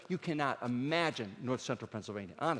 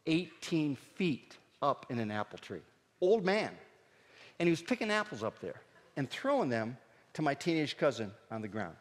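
normal talking voice

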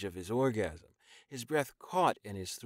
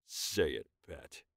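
lower, firm command; low demand; 6 semitones lower, 4 dB quieter than usual. Acted. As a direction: insist he say it. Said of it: "Rojan demanded".

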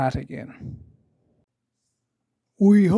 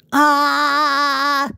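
George saying ah; 10 semitones higher, 6 dB louder than usual. Acted. George opening mouth for doctor.

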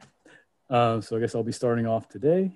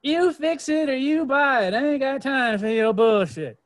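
much higher, louder sassy mama voice with a put-on twang; sassy Mama Nature; 11 semitones higher, 5 dB louder than usual. Mama Nature telling her kids to fix it, high sassy scold. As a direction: scold like a fed-up mother, high and loud. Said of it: "what Mama Nature always says".

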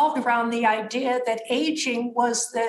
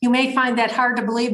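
usual speaking voice

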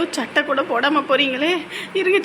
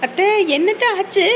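alarmed call; 6 semitones higher, 3 dB louder than usual. Acted. Hears mother-in-law screaming.

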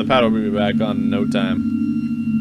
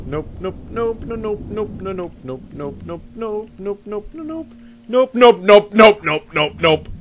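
panicked nopes; 8 semitones higher than usual. Real, not acted. Fleeing monster in horror game.

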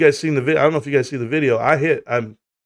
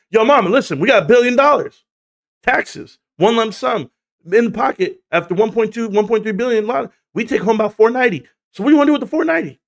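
higher, eager retelling of the lottery pitch; excited pitch; 8 semitones higher, 3 dB louder than usual. Retold or imitated. Husband pitching lottery plans to his wife, excited. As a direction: hype the billion-dollar plan to mama.

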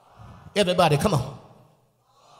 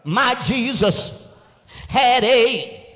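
call-and-response preaching; 6 dB louder than usual. Acted. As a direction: lead the congregation in repeating it. Preaching at full force.

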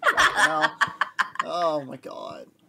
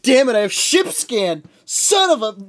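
frustrated outburst; 9 semitones higher, 11 dB louder than usual. Retold or imitated. Retelling frustration in game.

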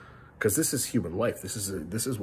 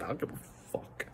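breathy, voiceless, much softer shrug-off; whispered defiance; 5 semitones higher, 10 dB quieter than usual. Real, not acted. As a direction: mutter it to yourself with no voice. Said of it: "I'm cursing".

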